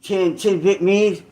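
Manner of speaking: ordinary talk, nothing marked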